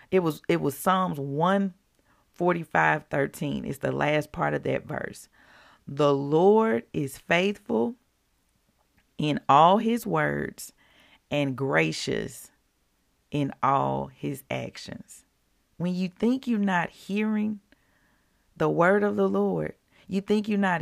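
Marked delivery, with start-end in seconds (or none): 5.9-15.2 slow, deliberate Bible reading; scripture reading. Acted. Reading Psalms to her viewers, slow and reverent. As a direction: read each phrase slowly and reverently. Rereads the key verse.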